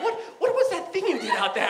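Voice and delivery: high, amazed, lightly voiced nebbish; mock awed Woody Allen. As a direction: ask it with awed disbelief.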